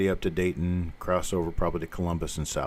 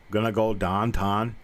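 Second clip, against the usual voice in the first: slow Pittsburgh "downtown" with a flat ah; Pittsburgh accent, slow. Shown as a demonstration. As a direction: lightly do a western Pennsylvania local. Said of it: "the people over in the western part".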